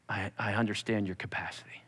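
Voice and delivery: soft tender whisper. Pretend. God whispering comfort to Chris, hushed. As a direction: breathe it like a secret comfort.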